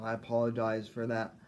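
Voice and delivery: ordinary delivery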